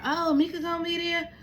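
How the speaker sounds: high, loud mocking question; mock the family's curiosity in a high voice; mimicked nosy relatives. Relatives asking about the Christmas party, high mocking mimicry. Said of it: "and they wondering".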